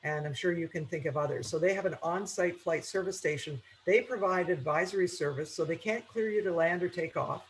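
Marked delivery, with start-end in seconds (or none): none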